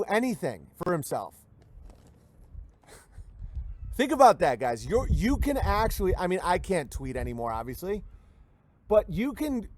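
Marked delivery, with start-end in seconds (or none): none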